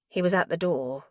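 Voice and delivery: ordinary delivery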